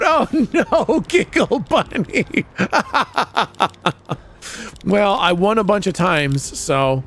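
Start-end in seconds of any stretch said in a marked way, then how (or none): 0.0-4.3 high voice breaking into giggles; real laughing. Real, not acted. Streamer cracking up at Giggle Bunny's losses, high laughing. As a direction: squeal it out, then collapse laughing.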